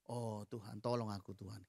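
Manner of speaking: softly pleading prayer, well below speaking volume; quiet plea. Shown as a demonstration. Preacher showing Maria's prayer to the congregation, soft and pleading. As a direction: murmur it like a broken-hearted prayer. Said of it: "Kira-kira begini kalau saya".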